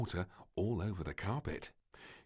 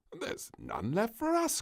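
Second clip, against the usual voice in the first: higher dismayed queen; lightly voiced queen; queen crying out; 4 dB louder than usual. A narrator's reading. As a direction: lament the empty pot. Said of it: "cried the queen".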